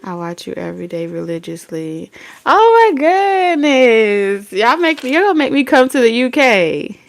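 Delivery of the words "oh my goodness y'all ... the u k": flattered excitement. Real, not acted. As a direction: gush in happy disbelief, louder and higher. Gushing at a devoted viewer's message.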